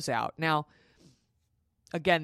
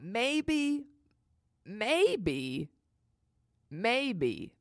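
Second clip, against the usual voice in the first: high, drawn-out first maybe, then easing; hopeful excitement; 7 semitones higher, much slower than usual. Retold or imitated. Expectant mother thinks labor may be near, hopeful excitement. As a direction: let each maybe hang in hope. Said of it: "I thought that was exciting".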